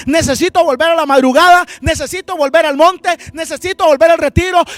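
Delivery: loud, driving revival sermon; full-force preaching. Real, not acted. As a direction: hammer out each urgent declaration to the congregation.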